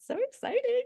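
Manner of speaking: very high-pitched gushing squeal; giddy excitement. Acted. Mimics someone thrilled by a new idea.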